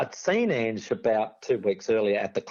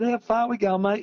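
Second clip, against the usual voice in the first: raised voice calling a mate; 9 semitones higher than usual. Retold or imitated. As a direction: call up the ladder, higher. Calling out to Greg on ladder.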